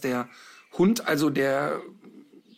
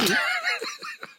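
high shrieking laugh bursting out; burst of laughter; 4 dB quieter than usual. Real, not acted. Caught off guard by the co-host's question, a high burst of laughter. Host asks if it's the FC coach.

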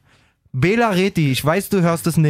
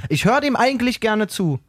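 usual speaking voice

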